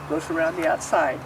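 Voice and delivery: ordinary delivery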